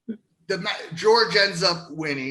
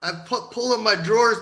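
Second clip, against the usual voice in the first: normal talking voice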